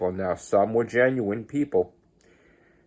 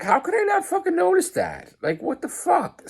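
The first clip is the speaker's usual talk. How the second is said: exasperated disbelief. Retold or imitated. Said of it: "And I'm like".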